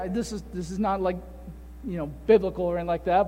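ordinary talk

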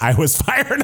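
mock confession through a laugh; imitating her with a laugh; laughing quote. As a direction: giggle through the word fired. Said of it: "she was like".

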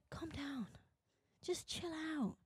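very soft, hushed telling-off; quietly damp someone down; calming. Acted. Society telling competitive people to settle, hushed and calm. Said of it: "it's that calmness".

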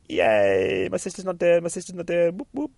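high, sing-song little cheer; mock celebration. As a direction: squeak a happy victory chant as the girl. Girl celebrates sister alive.

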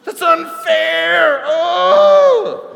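hammed-up tantrum cry of a furious daughter; over-the-top wailing meltdown at mom; screaming child tantrum. She replies.

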